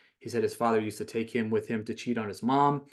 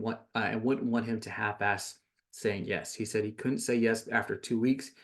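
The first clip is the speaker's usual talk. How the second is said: usual speaking voice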